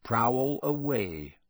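slow, drawn-out menace; lingering, menacing permission; full of menace. A narrator's reading. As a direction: invite him with a veiled threat. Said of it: "his voice was full of menace".

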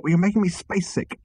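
high-pitched queasy plea; pleading. A narrator's reading. Arthur begging Zaphod as the ship lurches, pleading. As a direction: plead for it to stop. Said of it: "pleaded Arthur".

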